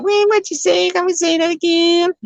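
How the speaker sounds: very high, squeaky caricature voice; mock baffled American. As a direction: squeal the question as a mocking imitation. Mimics Americans not understanding him.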